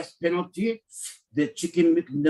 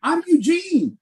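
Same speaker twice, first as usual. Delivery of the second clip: hammed-up Eugene; 8 semitones higher than usual. Playing Eugene bragging.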